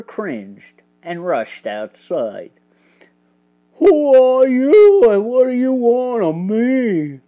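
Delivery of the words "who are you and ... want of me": carpenter character voice. A narrator's reading. Hawkins confronts strangers outside, in his loud character voice.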